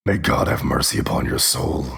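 low, unvoiced doom whisper; ominous whisper. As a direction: intone it like a grim judge. Echoes line in ominous voice.

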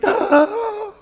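sharp high gasp of relief; high excited gasp. Retold or imitated. Parents spotting their son, a sharp high gasp. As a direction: gasp on seeing the lost boy.

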